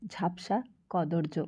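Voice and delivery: ordinary delivery, nothing marked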